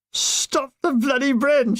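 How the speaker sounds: high, slow shouted order. Acted. Sergeant shouting at laughing gunners. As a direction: bark it to stop the noise. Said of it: "shouted Dawson".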